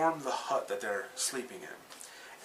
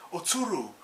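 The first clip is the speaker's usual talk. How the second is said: calling out; 8 semitones higher, 4 dB louder than usual. Retold or imitated. Afro warning Okiku of an attacker, loud call. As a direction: call her name again, warning. Afro calls out to warn her.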